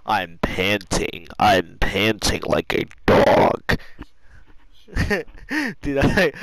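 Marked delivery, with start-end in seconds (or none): 0.1-3.8 low, slow, loud strained croak; nearly lost voice. Real, not acted. Worn out from laughing, nearly lost voice. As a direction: force it out slowly with almost no voice left. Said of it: "I almost lost my voice".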